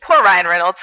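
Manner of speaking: ordinary talk, nothing marked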